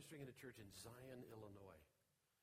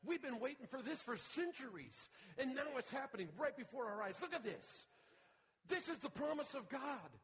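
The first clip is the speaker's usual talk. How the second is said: preaching at full force; 11 semitones higher, 11 dB louder than usual. Acted. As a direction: announce the long-awaited promise in awe.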